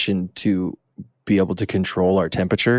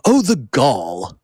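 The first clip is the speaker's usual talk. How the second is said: slow, higher mock-indignant exclamation; imitating his folks; 4 semitones higher, much slower than usual. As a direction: draw it out like a scandalized parent. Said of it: "my folks would always say it".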